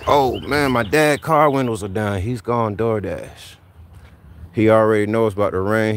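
ordinary talk

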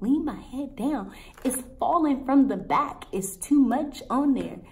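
high, loud disbelieving objection; incredulous protest. Retold or imitated. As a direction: argue back in disbelief. Retold protest to the assistant.

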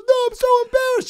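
very high, quick squeal; high-pitched mock-female whine; mimicking embarrassed woman. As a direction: imitate her wailing from behind a door. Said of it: "She goes".

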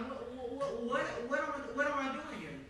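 high, rattled repeated whats; frantic stammer. Retold or imitated. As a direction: stumble over the words in a panic. Retells his frantic confusion.

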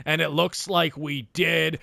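ordinary talk

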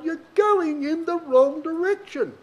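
high, slow, pressing delivery on "going"; emphatic warning. Real, not acted. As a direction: lift the pitch and slow right down to warn them. Preacher presses the point home.

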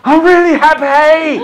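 high, loud overjoyed; mock over-the-top delight, high and loud; gushing joy. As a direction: burst with exaggerated happiness. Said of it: "we don't say".

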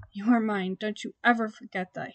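plain reading voice

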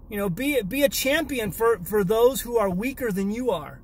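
ordinary talk, nothing marked

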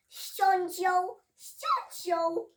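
loud furious royal command; angry queen. Acted. Queen furiously ordering Alice beheaded, loud. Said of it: "zawołała w gniewie królowa".

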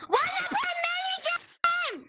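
high, loud yelling; shouting. Real, not acted. Screaming in the mini game.

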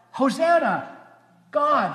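ordinary talk, nothing marked

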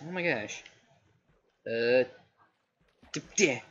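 usual speaking voice

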